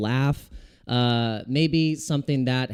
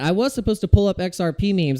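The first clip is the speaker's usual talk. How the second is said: ordinary delivery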